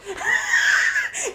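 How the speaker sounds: high, squealing laugh; shrill cackle of real amusement. Real, not acted. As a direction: burst into helpless high laughter. Laughs at the bewildered reaction.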